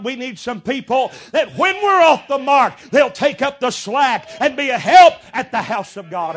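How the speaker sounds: loud, high preacher's cry; fiery preaching. Acted. Preacher rousing his congregation, loud fiery preaching. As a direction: preach it out to the pews.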